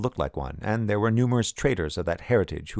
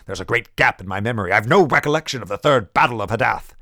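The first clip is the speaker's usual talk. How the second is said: louder, higher, forceful outburst; angry general; 4 semitones higher, 7 dB louder than usual. Acted. General railing at the scholars about his lost memory, loud and heated. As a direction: push it out like an offended commander.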